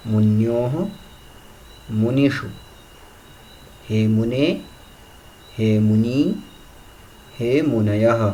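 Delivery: word-by-word declension chant with pauses; recitation drill. Shown as a demonstration. Teacher reciting noun forms for students, measured chant. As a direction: recite each form clearly, one at a time. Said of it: "एक बार फिर सुने आप सभी ध्यान से".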